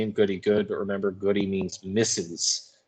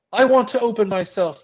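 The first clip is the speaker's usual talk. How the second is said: a rapt, much higher, louder cry; enraptured; 12 semitones higher, 5 dB louder than usual. A narrator's reading. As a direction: cry it out as if lit from within. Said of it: "She is enraptured".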